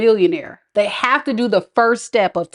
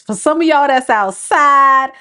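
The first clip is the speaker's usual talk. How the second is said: ordinary delivery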